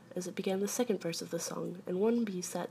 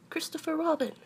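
high-pitched storybook call; 10 semitones higher than usual. A narrator's reading. Pooh calls down from the balloon, high call. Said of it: "he called down to you again".

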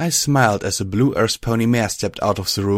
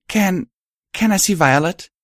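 hesitant; 10 semitones higher, 3 dB louder than usual. Acted. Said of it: "I ventured to ask".

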